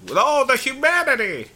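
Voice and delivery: high, loud dramatic announcer; old radio announcer. Retold or imitated. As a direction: wail it like a newsreel reporter. Hindenburg radio announcer impression.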